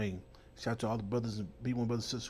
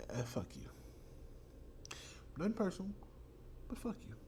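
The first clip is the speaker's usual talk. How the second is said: mock dismissive brush-off; 7 semitones higher, 9 dB quieter than usual. Mocking dismissal in quote.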